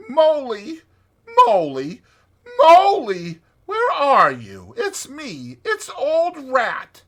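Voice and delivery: high, loud cheerful call into the wood. A narrator's reading. Rat searching the dark wood for Mole, calling cheerfully. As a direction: call out brightly for a lost friend. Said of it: "calling out cheerfully".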